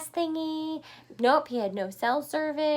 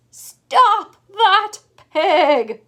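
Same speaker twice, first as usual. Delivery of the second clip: very slow, loud, higher shout; 6 semitones higher, 16 dB louder, much slower than usual. Acted. Shepherd chasing the escaping pig, loud shout. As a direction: bellow after the runaway. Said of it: "shouted the shepherd".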